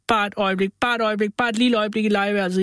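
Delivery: mock whining kids begging for a moment; children wailing. A narrator's reading. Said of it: "jamrede de".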